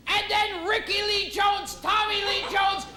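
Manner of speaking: normal talking voice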